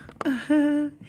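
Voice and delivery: high giggle. Real, not acted. Laughing at calling herself a designer, high giggle. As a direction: giggle at yourself.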